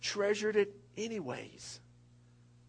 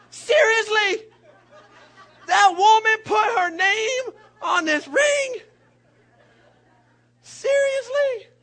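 high, loud, slow squeal of disbelief; mock outraged disbelief; 12 dB louder, slower than usual. Pastor in the store, shrieking in mock disbelief at the engraved ring. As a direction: shriek it like you can't believe it.

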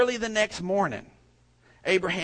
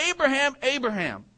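high-pitched, urgent-sounding call of a name; calling out from heaven; 7 semitones higher than usual. A narrator's reading. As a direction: call down to someone, voice raised in pitch. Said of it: "called out to him from heaven".

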